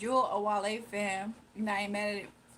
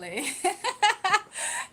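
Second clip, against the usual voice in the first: high burst of laughter; 10 semitones higher, 8 dB louder than usual. Real, not acted. Laughing at name mixup.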